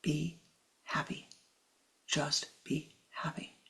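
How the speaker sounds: hushed and unhurried, like a guru's gentle advice; soft, slow blessing. Retold or imitated. Teacher quoted to the congregation, soft and slow. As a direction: let each word fall softly and slowly. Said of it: "when Kriyananda said".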